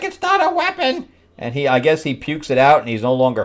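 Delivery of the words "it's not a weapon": very high-pitched cartoon protest; mock protest. Answering the demand to hand over the weapon, mock protest. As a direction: squeak out a hammed-up denial.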